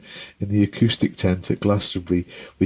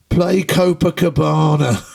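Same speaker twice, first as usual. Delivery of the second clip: put-on drunken heckling shout; 4 semitones higher, slower than usual. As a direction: bellow a request from the crowd. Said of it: "he just goes".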